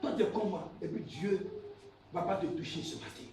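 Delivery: low, very soft sermon aside; hushed preaching. Real, not acted. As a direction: drop right down, as if confiding in them. Preacher drops to quiet aside. (prédication à voix basse)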